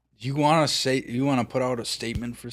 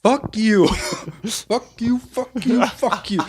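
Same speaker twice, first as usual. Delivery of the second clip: high, loud repeated insult; mock yelled insult; 11 semitones higher, 7 dB louder, slower than usual. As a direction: fling it at them over and over. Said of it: "you're just like".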